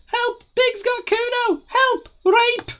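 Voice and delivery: shouting for help. Acted. Kuno shouting to the yard for help, shrill mock scream. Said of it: "the child shouts at the window".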